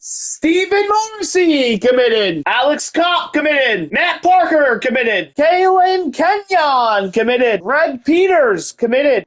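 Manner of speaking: slow, high, drawn-out announcing of each name; hype commit announcer. Acted. Gamer to his viewers, hyping each recruit's commitment in a slow announcer call. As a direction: call out every signing like a stadium announcer.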